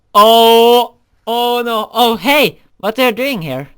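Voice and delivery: high, loud startled panic; flustered gasp at being caught. Acted. Caught with the creeper, a loud high startled panic.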